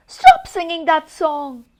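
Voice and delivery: loud pleading cry; begging. Acted. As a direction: beg him to stop singing. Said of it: "he begged the elf".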